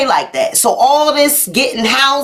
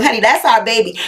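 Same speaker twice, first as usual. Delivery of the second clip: ordinary talk, nothing marked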